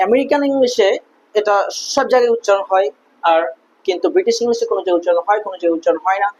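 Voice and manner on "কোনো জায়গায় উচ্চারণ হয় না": ordinary talk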